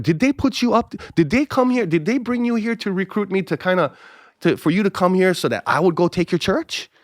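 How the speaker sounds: high-pitched incredulous grilling; suspicious questioning. Retold or imitated. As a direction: pile up the questions high, suspecting a set-up. Suspects a recruiting set-up.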